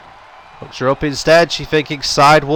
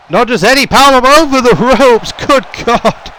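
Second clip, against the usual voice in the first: higher and louder, near shouting; excited shout; 8 semitones higher, 4 dB louder than usual. Real, not acted. Commentator shocked by a power bomb over the ropes, shouting. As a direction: yell it like you can't believe the spot.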